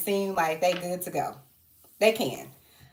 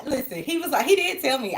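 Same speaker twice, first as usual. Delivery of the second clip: ordinary talk, nothing marked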